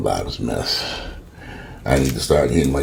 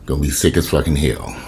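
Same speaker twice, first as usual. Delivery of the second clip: normal talking voice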